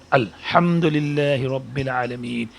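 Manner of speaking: الحمدللہ رب العالمین said with a single lam; slow careful recitation; correct recitation. Shown as a demonstration. Teacher gives the correct way, slow careful recitation. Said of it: "لیکن صحیح طریقہ کیا ہے".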